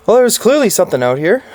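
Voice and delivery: rattled, talking fast; nervous excitement. Real, not acted. Rattled after a frightening noise.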